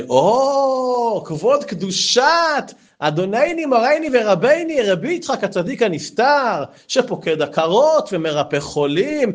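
high, slow ironic reverence; mock grandeur. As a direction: pile on the titles teasingly. Rebbe mockingly honors him with titles.

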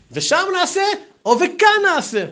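high-pitched, pleading either-or question; eager mock questioning; acted insistent question. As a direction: put both options in a high, pressing voice. Retold question offering two wordings.

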